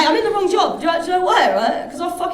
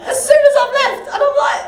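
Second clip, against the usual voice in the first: much higher, louder outraged rant; rising indignation at the stranger; high-pitched outraged disbelief; getting angry; 10 semitones higher, 6 dB louder than usual. Retold or imitated. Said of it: "I'm getting angry".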